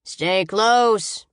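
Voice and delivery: loud, drawn-out bored call. A narrator's reading. Guard calling after the girl storming off, bored. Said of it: "he calls out in a bored voice".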